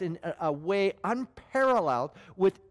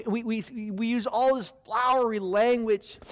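ordinary talk, nothing marked

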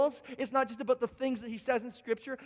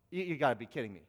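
low, disbelieving wife voice; exasperated wife, low; 9 semitones lower, 4 dB quieter, much faster than usual. Acted. Wife scoffs at her clueless husband in a low voice.

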